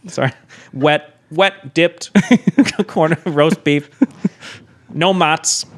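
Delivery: put-on thick Chicago deli guy accent; high, hammed-up Chicago counterman. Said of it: "do, like, a heavy Chicago accent".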